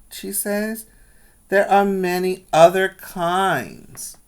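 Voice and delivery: put-on lively read-aloud for children; storybook reading voice; story narration. A narrator's reading. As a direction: tell the story brightly to the class. Teacher reads picture book aloud.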